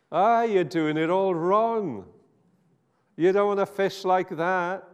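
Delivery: a voice hailing the boat, lightly voiced; voice from the shore. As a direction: call out a bossy correction from the shore.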